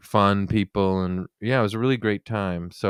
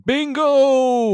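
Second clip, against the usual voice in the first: high, triumphant game-show cry; excited bingo; 4 dB louder than usual. Real, not acted. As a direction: call it like you just won. Game-show call on a guess.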